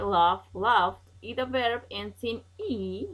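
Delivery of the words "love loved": loud model words. Shown as a demonstration. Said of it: "we are going to add E D".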